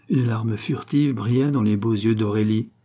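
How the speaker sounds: plain reading voice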